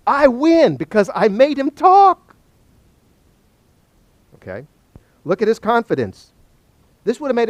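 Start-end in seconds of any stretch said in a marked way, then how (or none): 0.1-2.2 loud, high victory cry; triumphant boast. Acted. Job confident he beats God either way, loud triumphant boast. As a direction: crow it out, rising to the last word.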